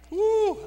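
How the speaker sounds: high, loud "woo"; excited whoop. Real, not acted. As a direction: let out a quick, high whoop. Exuberant whoop between remarks to congregation.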